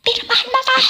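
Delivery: high mock yell of a fan. Singer imitating a fan yelling at her event. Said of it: "May sumigaw dun sa akin".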